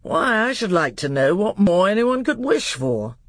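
put-on pompous uncle taken aback by the boy; puffed-up royal disbelief; Miraz. King scolds his doubtful nephew.

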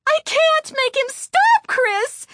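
very high, loud, panicked cry; trembling voice. A narrator's reading. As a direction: cry out in terror. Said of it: "cried in a trembling voice".

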